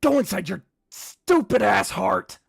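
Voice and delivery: high, very loud, drawn-out order; yelling inner voice. Acted. Voice orders Kip into their heart, loud high yell. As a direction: yell it high and full force, stretching the words. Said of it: "you hear the voice go".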